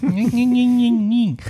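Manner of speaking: high, singsong whine of mockery; mock nagging. Host mocks a listener's pronunciation complaint, nagging whine. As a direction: imitate a pedant's nagging as high nonsense syllables. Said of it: "me escribe y me exige para decirme".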